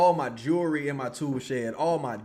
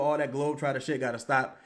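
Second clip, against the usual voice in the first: ordinary delivery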